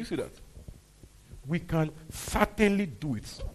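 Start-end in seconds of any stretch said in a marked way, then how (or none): 1.5-3.4 loud, slow, emphatic declaration; voice of faith. Acted. Preacher voicing Caleb's faith to the church, emphatic and slow. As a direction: drive CERTAINLY home with conviction. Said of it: "That's the voice of faith".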